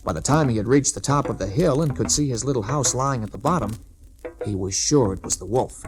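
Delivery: plain reading voice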